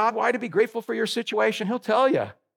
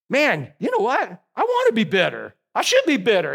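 ordinary talk, nothing marked

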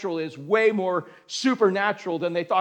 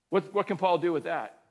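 usual speaking voice

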